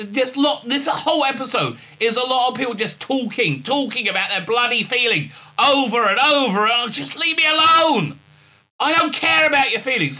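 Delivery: high-pitched fed-up outburst; exasperated rant. Real, not acted. Reviewer fed up with talk of feelings, exasperated. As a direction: build to a pleading, exasperated cry.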